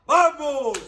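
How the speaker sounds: loud, high victory cheer; cheering shout. Real, not acted. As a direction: cheer for the team. Cheering after goal.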